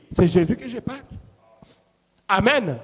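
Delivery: normal talking voice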